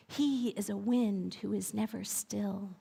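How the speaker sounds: the first breeze whispering softly. Acted. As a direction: breathe it like a faint wind. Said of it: "breeze that whispered".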